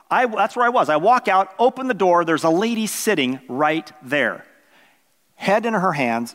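ordinary talk, nothing marked

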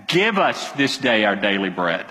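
low-pitched, firm demand; emphatic imperative. Shown as a demonstration. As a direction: pray it as an emphatic request. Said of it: "It's emphatic".

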